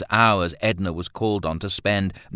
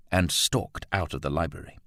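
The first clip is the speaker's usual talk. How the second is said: usual speaking voice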